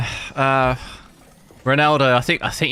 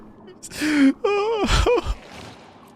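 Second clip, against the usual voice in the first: high giggle breaking out of a fake cry; laughing. Real, not acted. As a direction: let the fake sob collapse into laughter. Laughs after fake crying.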